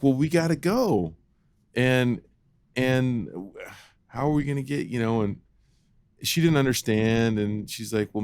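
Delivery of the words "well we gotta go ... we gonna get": higher, impatient mother pushing to leave; a hint of a flustered mom hurrying the kids; hurried mom. Mom voiced in retold story.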